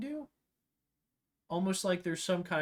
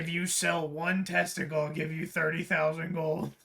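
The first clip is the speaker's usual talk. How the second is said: shady merchant voice; 3 semitones higher, 4 dB louder, slower than usual. Acted. Dealer offer, slow.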